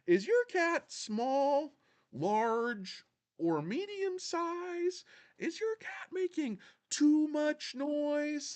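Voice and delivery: very high, slow commercial pitch; strained high voice drawing out each ad question; pitchman impression. Pitching mittens to cat owners, hammy pitchman.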